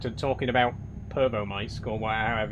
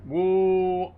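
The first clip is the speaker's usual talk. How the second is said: loud, high "woo"; sustained loud woo; whoop; 6 semitones higher, 6 dB louder than usual. Real, not acted. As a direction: let out one long high whoop. Sudden whoop while driving.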